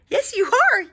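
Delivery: yelp. A narrator's reading. Eddie teases the bear, yelping. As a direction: yelp it out teasing. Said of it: "yelped Eddie".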